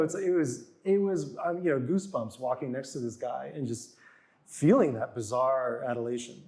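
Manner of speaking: ordinary delivery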